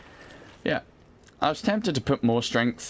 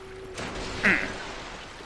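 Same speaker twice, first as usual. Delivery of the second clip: throat clear; 7 semitones higher, 5 dB quieter than usual. Real, not acted. Loud throat clear alone.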